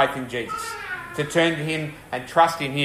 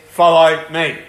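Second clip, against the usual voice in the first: loud, direct order; firm command; 7 dB louder than usual. Acted. Jesus calling the brothers to follow, firm command. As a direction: give it as a command, not a question. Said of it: "as a command".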